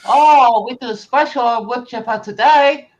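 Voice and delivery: high, loud pushy salesman; foreign salesman. Acted. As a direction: sell it hard, broken English. Salesman with broken grammar.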